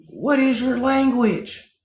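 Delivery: prissy sing-song challenge; mocking outsider. Mocking people who ask natives their language, high and slow. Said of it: "So when they tell you".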